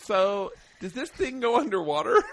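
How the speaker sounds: high, laughing, clueless voice; dumb question voice. Retold or imitated. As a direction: ask it like a clueless tourist. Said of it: "and she goes".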